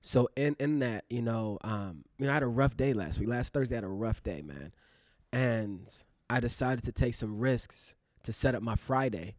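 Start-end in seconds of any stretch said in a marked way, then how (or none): none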